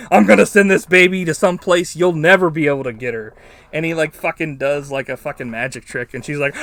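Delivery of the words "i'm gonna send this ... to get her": mock threat. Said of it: "Willow is like".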